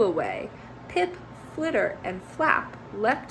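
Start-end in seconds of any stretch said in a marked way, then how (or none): none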